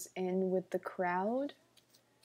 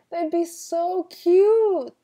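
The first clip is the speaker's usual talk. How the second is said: high, loud, drawn-out squeal; squealing over something adorable; gushing; 9 semitones higher, 12 dB louder, much slower than usual. Real, not acted. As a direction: gush with CUTE stretched long. Gushing over tiny horses.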